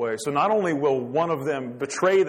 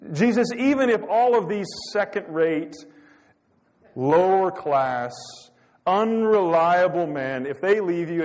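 mock disparaging boast; 4 semitones higher, 6 dB louder than usual. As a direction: imitate a self-promoter running down his companions. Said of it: "he throws everybody else underneath the bus".

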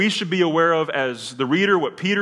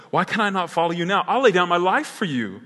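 raised, insistent pledge; bold, arrogant Peter; 6 semitones higher, 5 dB louder than usual. Acted. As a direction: declare it higher and louder, full of confidence. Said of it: "So, so Peter is bold".

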